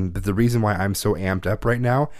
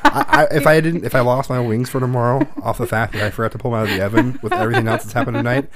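breaking into laughter mid-sentence; laughing; 2 semitones higher, 3 dB louder than usual. Real, not acted. Joking with her partner about the wings, laughing. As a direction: laugh through the words.

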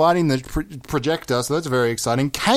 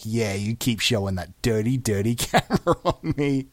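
secretly pleased; 8 semitones lower, 4 dB quieter than usual. Pretend. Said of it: "But secretly, you'd see it".

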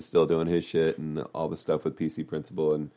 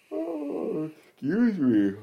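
yawning through the words; 14 semitones higher, much slower than usual. Real, not acted. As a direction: stretch it out in a big yawn. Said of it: "I'm yawning".

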